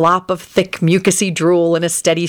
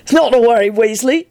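lightly sardonic reassurance, pitched up; 6 semitones higher than usual. A narrator's reading. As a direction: reassure with a touch of sarcasm. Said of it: "Sirius informed him sardonically".

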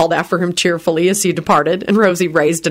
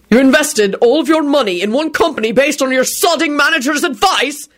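hollered; 9 semitones higher than usual. A narrator's reading. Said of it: "he hollered".

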